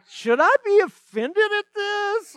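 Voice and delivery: very high, drawn-out squeaky disbelief; mock offended. Substitute reacts to the girl's question, mock offended. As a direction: voice shoots up in comic indignation.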